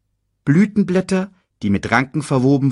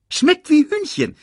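high, quick cartoon meerkat; chirpy little sidekick voice; 3 dB louder than usual. Timon adds.

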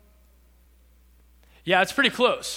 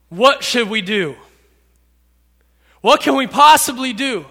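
plead, more desperate on the second question; sorrowful desperation; 3 semitones higher, 6 dB louder than usual. Acted. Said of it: "With deep sorrow".

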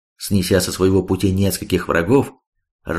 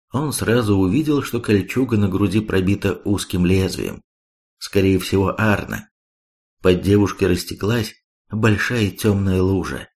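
ordinary delivery

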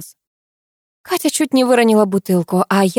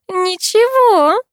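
high, slow giggly reply; drawn out with a teasing giggle in the voice; 13 semitones higher, 4 dB louder, much slower than usual. A narrator's reading. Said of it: "она хихикнула".

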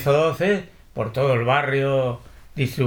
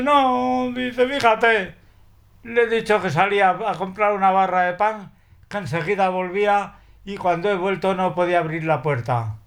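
high, tearful imitation of a distressed woman; voicing the upset woman as if near tears; almost crying; 6 semitones higher than usual. The upset woman Josefina on the phone, imitated high and tearful. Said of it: "casi llorando".

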